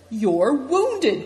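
high, loud exclamation; alarmed cry. A narrator's reading. Good Fortune sees Affliction's wound, crying out. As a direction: cry out on spotting the injury. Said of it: "cried Good Fortune".

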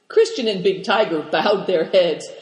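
ordinary delivery, nothing marked

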